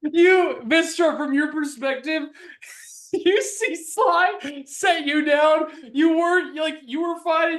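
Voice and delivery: loud, high-pitched disbelieving narration; incredulous recap. Real, not acted. Game master giving Vistra her perspective, incredulous. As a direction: play up the absurdity, high and forceful.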